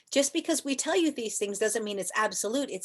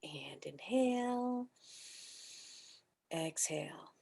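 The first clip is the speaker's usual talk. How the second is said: whispered breath cue; 2 semitones lower, 10 dB quieter, slower than usual. Shown as a demonstration. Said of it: "guides breathing".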